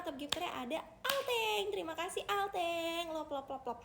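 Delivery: singsong gifter announcement with stretched names; announcer read-out. Real, not acted. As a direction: announce the winners, drawing out each name. Reads out top gifter list.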